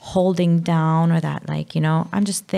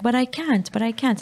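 put-on child's helpless voice; 6 semitones higher than usual. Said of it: "he'd be like".

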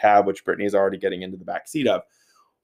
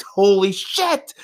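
higher, slow drawn-out holy shit; stunned yelp; 10 semitones higher, 4 dB louder, much slower than usual. Retold or imitated. Narrator reliving scalping himself on a lantern, slow high shock. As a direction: let the pain and shock stretch it out. Hitting his head on lantern.

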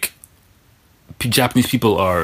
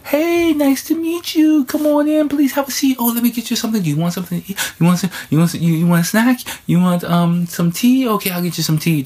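imitating gushing hosts; 14 semitones higher than usual. Company staff welcoming the new teacher, high gushing hospitality. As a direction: fuss over the guest in a high, sugary voice. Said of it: "The first time I went there".

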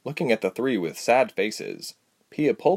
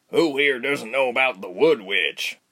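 usual speaking voice

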